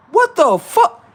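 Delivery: very high, loud what the fuck; startled high-pitched outburst; shocked. Acted. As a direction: shriek in disbelief.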